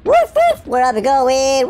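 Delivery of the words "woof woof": high, loud playful woofs; dog bark. Pretend. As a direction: bark like an excited puppy. Playing a dog, barks.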